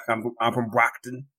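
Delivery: mock Boston accent. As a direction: say it like a Massachusetts local. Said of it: "Boston accent".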